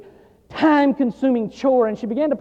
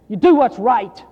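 usual speaking voice

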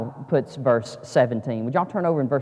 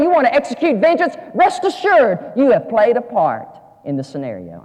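high, impassioned pulpit delivery building louder; full-force preaching; 12 semitones higher, 8 dB louder than usual. Real, not acted. Preacher confessing how God broke him, high impassioned preaching. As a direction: drive it home to the congregation.

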